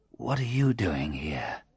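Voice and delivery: soft, effortful question; barely manage to get it out; weak voice. A narrator's reading. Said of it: "I managed to say".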